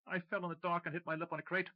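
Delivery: high, nervous lying voice; nervous lie. Acted. McCracken lying to Tim about his split lip. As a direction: cover the lie with a jittery high voice.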